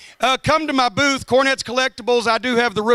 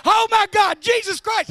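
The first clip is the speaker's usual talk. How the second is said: high, frantic yell of a trapped passenger; panicked cry; 9 semitones higher than usual. Retold or imitated. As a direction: cry out, stuck in the seatbelt as the train comes.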